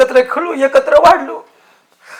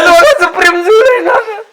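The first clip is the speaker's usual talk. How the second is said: sobbing; 4 semitones higher than usual. Acted. Heartbroken man telling Nana they are in love, sobbing. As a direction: wail it out through sobs.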